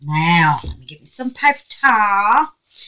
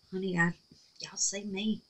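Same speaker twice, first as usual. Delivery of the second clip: usual speaking voice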